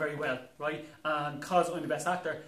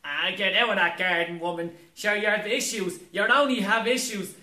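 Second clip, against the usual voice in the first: louder, scolding country voice; gruff panto woman; 5 semitones higher, 6 dB louder than usual. Acted. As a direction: tell her off broadly. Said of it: "I'm gonna do all the roles".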